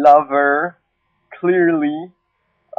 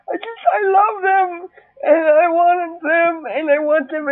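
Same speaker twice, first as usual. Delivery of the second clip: higher, crying laugh; laughing through tears; 6 semitones higher than usual. Real, not acted. Vlogger gushing about cats, laughing through real tears. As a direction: crack up and cry at once.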